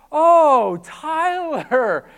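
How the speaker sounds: high, loud embarrassed "oh"; catching on, loud and high; surprised realisation. Retold or imitated.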